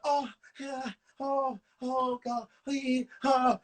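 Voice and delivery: mock moaning, drawn out; hammed-up pleasure noises; imitated sex moans. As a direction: moan in mocking imitation of a lover. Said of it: "you was just all up in there, like".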